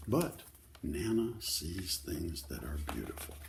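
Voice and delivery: usual speaking voice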